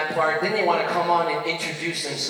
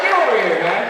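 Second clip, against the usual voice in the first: louder, higher call; mock challenge; 5 semitones higher, 6 dB louder than usual. Boyfriend confronts the dancing Brazilian guy, loud and challenging. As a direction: square up to the guy. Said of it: "I'm like".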